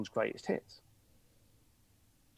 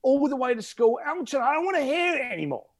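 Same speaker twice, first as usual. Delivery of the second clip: put-on teenage complaint to Mum; 10 semitones higher than usual. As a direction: whine at Mum like a fed-up teenager. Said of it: "I used to say to Mum".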